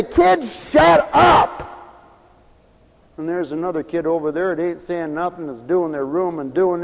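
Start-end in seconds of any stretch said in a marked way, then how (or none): 0.0-1.7 slow, loud, high exasperated shout; fed-up parent. Retold or imitated. Parent losing patience with a crying kid, shouting. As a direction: bark it at the whining kid.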